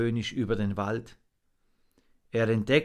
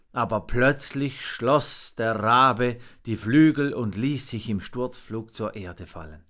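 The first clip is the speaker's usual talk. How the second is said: plain reading voice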